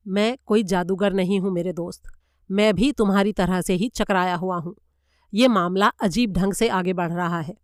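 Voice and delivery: usual speaking voice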